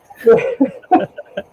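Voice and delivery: high laugh. Real, not acted. Laughs as Amber joins.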